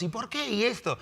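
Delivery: high, small questioning voice; mock questioning. Believer who likes questioning God, imitated pestering. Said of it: "si eres de los que le gusta preguntar".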